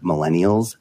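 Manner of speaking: normal talking voice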